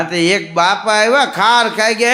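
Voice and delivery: ordinary delivery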